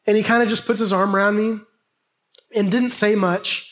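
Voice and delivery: ordinary delivery, nothing marked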